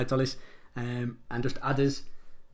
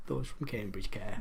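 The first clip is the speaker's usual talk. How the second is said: low, slow and softer; sober repeat; 5 semitones lower, 7 dB quieter, slower than usual. Real, not acted. Quizmaster defending people from Cambridge, low sober repeat. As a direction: bring it down, slower and quieter, as a settled point.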